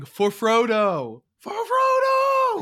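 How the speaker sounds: slow, loud rallying shout climbing high; Aragorn battle cry. Retold or imitated. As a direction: bellow it like a king leading a charge. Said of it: "Aragorn saying".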